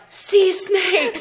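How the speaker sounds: high-pitched imitated alarm shout; retold yelling. Onlookers spotting a sea snake, imitated yelling. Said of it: "then they start yelling".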